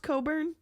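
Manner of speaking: "Coburn" with the "ck" dropped, first part said "co"; pronounced Coburn. Shown as a demonstration. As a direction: say "Coburn" with a long o and no k sound. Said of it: "that's actually pronounced Coburn".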